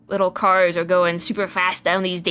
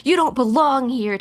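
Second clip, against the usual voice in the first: higher, insistent acted tirade; mock boastful rant; 6 semitones higher than usual. Shiro telling Vinny he doesn't belong, heated boast. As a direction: push it higher, acting a heated rival.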